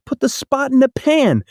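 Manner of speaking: ordinary delivery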